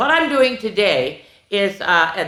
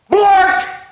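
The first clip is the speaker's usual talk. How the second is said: high, loud yelp; nonsense word blurted; 8 dB louder than usual. A narrator's reading. Reader forced to say nonsense, loud silly outburst.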